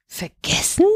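half-whispered, rising question; ungläubig. A narrator's reading. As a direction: echo it in disbelief. Wife doubts husband's excuse. (ungläubig gehauchte Rückfrage)

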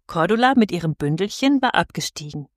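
ordinary delivery, nothing marked

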